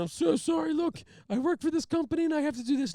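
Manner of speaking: high, loud whimpering excuse; hammed-up pleading; mock tearful plea. Pleading with the trooper, high and whiny.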